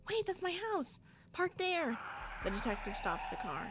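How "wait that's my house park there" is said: high, urgent call; sudden alarmed realization; urgent surprise. Acted. Character urgently spots house.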